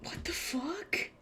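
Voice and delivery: slow, breathy whispered disbelief; stunned whisper. Real, not acted. Streamer stunned by a figure reveal, whispering in disbelief. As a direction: whisper it, too shocked to speak up.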